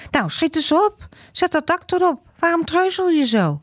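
put-on high, pushy kid's voice hurrying a friend; an impatient child urging his friend on; impatient boy. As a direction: nag him to hurry up, no patience left. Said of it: "Maar Joran wordt ongeduldig".

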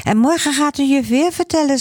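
put-on high, quick little boy's voice; excited child. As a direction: rattle it off like an eager small boy. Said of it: "zegt Gijsbert". (hoge, snelle kinderstem)

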